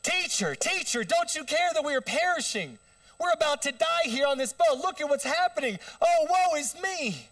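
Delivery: hammed-up, high loud shriek of doom; panicked wailing. Disciples in a sinking boat waking Jesus, shrieking in panic. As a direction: cry out in theatrical terror, pitch way up. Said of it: "I don't think that's how they said it".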